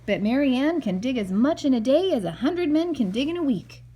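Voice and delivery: lightly voiced proud workman; boastful Mike. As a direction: brag about Mary Ann. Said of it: "said Mike".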